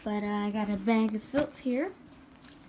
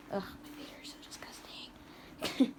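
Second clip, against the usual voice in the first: voiceless, soft "Ugh, my feet are so disgusting"; embarrassed whisper; 7 dB quieter than usual. Real, not acted. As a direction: whisper it as a mortified aside. Embarrassed remark about own feet.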